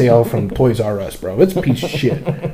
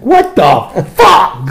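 drawn-out, higher, louder what the fuck; slow disbelief; 7 semitones higher, 6 dB louder, much slower than usual. Retold or imitated. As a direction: stretch it out in disbelief. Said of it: "I'm like".

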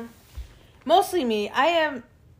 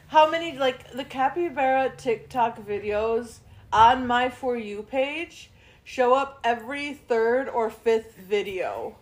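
usual speaking voice

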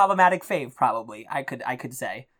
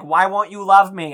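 put-on drunk pleading; 4 semitones higher, 6 dB louder than usual. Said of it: "she was drunk and she was like".

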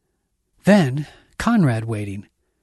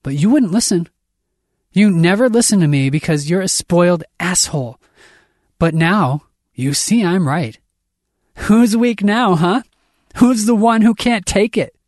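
higher, louder, bitter accusation; heated, raised-voice outburst; angry taunting; 7 semitones higher, 6 dB louder than usual. Acted. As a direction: throw it at them as a furious taunt. Angry character taunting before a fight.

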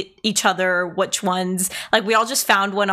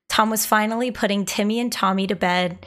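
ordinary talk, nothing marked

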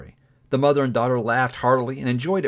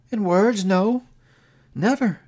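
put-on a lightly higher young woman, sad and steady; a hint of a hurt young woman letting go; Belle, lightly voiced; 8 semitones higher, 3 dB louder, slower than usual. A narrator's reading. Young woman to her changed lover, lightly voiced and sorrowful.

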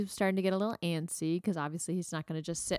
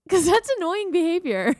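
excited; 11 semitones higher, 9 dB louder than usual. Real, not acted. Laughing defensive.

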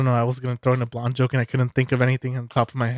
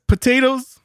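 high potatoes cry; 11 semitones higher, 3 dB louder, slower than usual. Retold or imitated. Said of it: "would scream potatoes".

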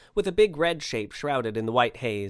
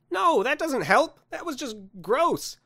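high, slow complaint; disgusted protest; 14 semitones higher, 4 dB louder, slower than usual. Acted. Man objects to Santa's tongue display, disgusted. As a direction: draw it out in high-pitched revulsion.

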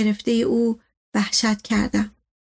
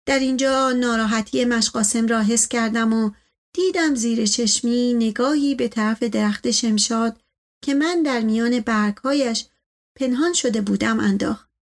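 usual speaking voice

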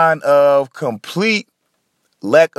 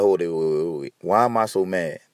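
low, quieter talk to oneself; imagined self-questioning; 9 semitones lower, 4 dB quieter than usual. Acted. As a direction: lightly act out checking yourself, pitched down. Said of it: "Asking yourself questions like".

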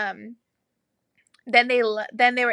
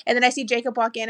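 ordinary talk

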